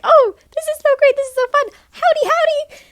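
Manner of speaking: high, loud mocking fake enthusiasm; mock the actors' fake cheerful greeting, high and loud. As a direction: ham up their fake cheeriness. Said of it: "Jim and Matt are fake for being like".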